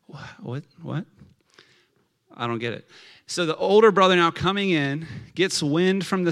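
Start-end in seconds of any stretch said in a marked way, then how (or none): 0.1-2.9 hushed, halting mock bafflement; quiet stunned confusion, acted out; dumbfounded. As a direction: stammer softly as if nothing computes. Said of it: "like, dumbfounded".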